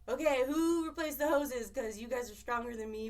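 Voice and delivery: high and loud, calling to the crew, exasperated. Retold or imitated. Captain calling her crew about the leaking hoses, high, loud and exasperated. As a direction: demand it of the crew, fed up and loud.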